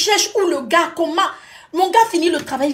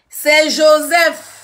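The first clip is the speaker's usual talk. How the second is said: slow louder Joseph reply; 7 dB louder, much slower than usual. Retold or imitated. Joseph repeating at door.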